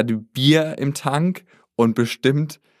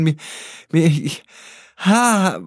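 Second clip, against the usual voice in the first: flustered panic; 5 semitones higher than usual. Retold or imitated. Reliving panic attack confusion.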